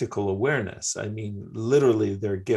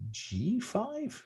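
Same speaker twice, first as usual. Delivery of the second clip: higher and slow, baffled; incredulous; 14 semitones higher, 7 dB quieter than usual. Real, not acted. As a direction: draw it out in disbelief. Surprise at odd chess move.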